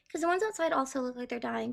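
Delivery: normal talking voice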